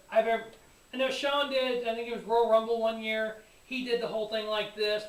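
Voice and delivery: ordinary delivery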